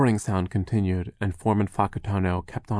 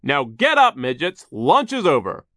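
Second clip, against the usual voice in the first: loud, higher foreman's command; bossy order; 6 semitones higher, 7 dB louder than usual. Acted. Foreman ends the children's lunch, loud and bossy. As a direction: order them onto their feet.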